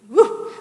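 sudden loud whoop of distaste; shuddering woo. Real, not acted. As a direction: let out a quick, loud "woo". Shudders at the thought of whining.

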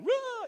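loud, high-pitched cartoon wail; mock bawling. Preacher imitating a young giver crying, mock bawling wail. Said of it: "like, bawling".

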